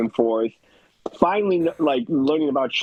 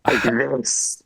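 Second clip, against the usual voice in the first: slow, hammed-up turned-on player; slow mock-sultry impression; mock aroused; 3 semitones lower, much slower than usual. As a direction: imitate a man far too into the game, slowly. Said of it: "sexually into this game".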